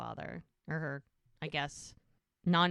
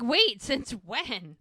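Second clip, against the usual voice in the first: loud, slow, higher disbelief; surprised; 5 semitones higher, 7 dB louder, much slower than usual. Retold or imitated. He learns she is the new fire chief, surprised. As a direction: stop her in surprise.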